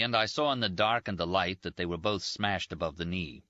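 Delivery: usual speaking voice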